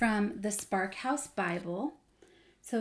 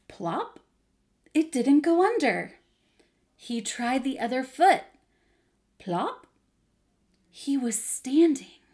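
animated storytime narration with big emphasis; lively Bible storyteller, playing up the drama; children's story reading; 5 dB louder than usual. A narrator's reading. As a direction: read it expressively to young children.

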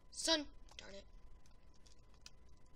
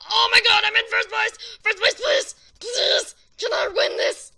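frantic excited shouting; 8 semitones higher, 20 dB louder than usual. Real, not acted. Leading the match, desperate to win.

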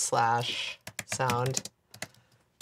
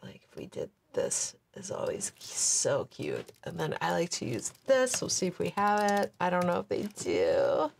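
ordinary talk

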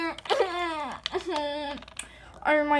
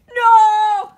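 much louder and higher no; mock shout; 6 semitones higher, 13 dB louder than usual. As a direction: yell no in pretend panic. Villain crying out.

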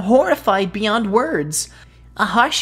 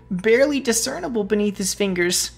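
plain reading voice

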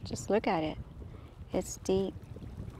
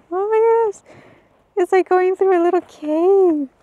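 much higher and louder, excited; excited squeal; 10 semitones higher, 9 dB louder than usual. Real, not acted. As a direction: squeal with thrill at the tight squeeze. Squeezing through narrow cave passage.

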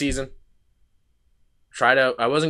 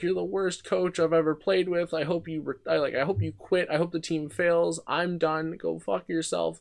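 retold tearful screaming; 7 semitones higher, 4 dB quieter than usual. Kid quitting, yelling at the coach, mock tearful scream. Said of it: "I'm screaming, crying at him".

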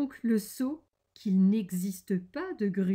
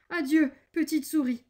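higher, louder hurried farewell; alarmed exclamation; 7 semitones higher, 6 dB louder than usual. A narrator's reading. The snake flees from the gruffalo, exclaiming a farewell. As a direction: call goodbye in acted alarm. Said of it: "s'exclama-t-il".

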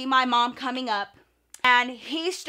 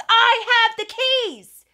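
high, loud, drawn-out mock yell; slow, high-pitched imitation of an indignant shout; retold exasperated shout; 10 semitones higher, 7 dB louder, slower than usual. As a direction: act out a fed-up mom hollering her proof. Retold mom proving she lives there.

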